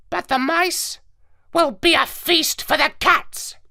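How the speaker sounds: high squawky parrot, explaining; lecturing like a clever parrot. Acted. Parrot lectures the dog narrator, high and squawky.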